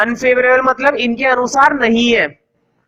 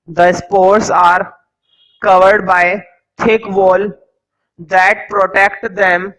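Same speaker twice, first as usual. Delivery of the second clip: each word spaced out slowly for note-taking; teacher dictating notes to the class slowly; slow dictation; 2 semitones lower, much slower than usual. Acted. Teacher to students copying notes, slow dictation.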